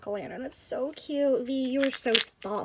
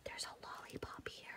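whisper; 21 dB quieter than usual. Real, not acted. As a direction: breathe it out secretly, no voice. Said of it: "My kid heard me".

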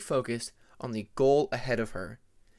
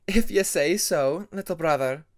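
lightly amused, fond tease; almost amused; 4 semitones higher, 8 dB louder than usual. Acted. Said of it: "almost amused".

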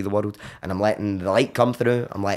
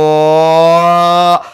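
long, loud bellowed "ho"; sonic vomit; 6 semitones higher, 11 dB louder than usual. Retold or imitated. Purging at the bucket, long loud roar. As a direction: heave out one huge sustained roar. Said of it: "filled with this sound".

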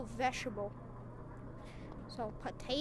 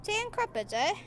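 a higher, lighter jokey British repeat; mock British accent; 6 semitones higher than usual. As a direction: toss the British line off once more. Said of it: "I'm not British".

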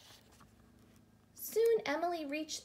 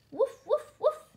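high, loud, punchy woofs; dog bark; 13 semitones higher, 9 dB louder than usual. A narrator's reading. The friendly dog comes to the rescue, barking. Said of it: "he barked".

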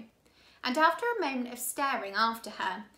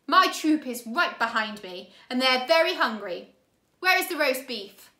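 loud, scratchy villain voice; demanding villain; 8 dB louder than usual. A narrator's reading. Count Olaf demanding roast beef from the children, loud. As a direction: bark the demand.

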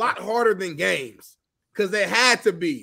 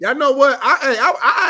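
laughing admission; 5 semitones higher, 7 dB louder than usual. Real, not acted. Laughing confession.

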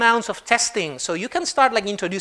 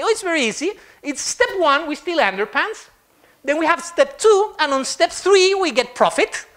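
lightly voiced cheerful gnome; 7 semitones higher than usual. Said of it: "they say".

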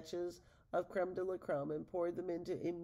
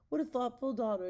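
higher, louder happy praise; mild delighted exclamation; 6 semitones higher, 5 dB louder than usual. Acted. Ogre mother praising Decima's gift, delighted exclamation. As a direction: gush over her daughter. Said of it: "exclaimed Hundred".

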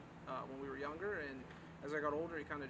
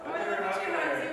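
squealing, stretched-out affection; cutesy cooing; 5 semitones higher, 13 dB louder than usual. Real, not acted. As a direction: gush over something adorably cute. Cooing over a cute hug.

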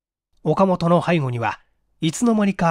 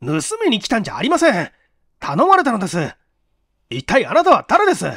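put-on high, fast, louder indignant young man; indignant, rising higher and louder; offended protest; 6 semitones higher, 5 dB louder, faster than usual. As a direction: bristle back at the accusation. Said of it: "岡本はムッとして".

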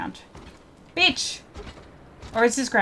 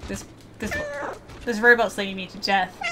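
normal talking voice

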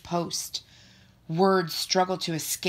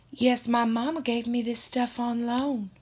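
put-on higher-pitched Texan girl voice; quiet Texan accent; 6 semitones higher than usual. A narrator's reading. Roxanne answers her teacher about mama's anklets, shy Texan accent. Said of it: "says so quietly in her Texan accent".

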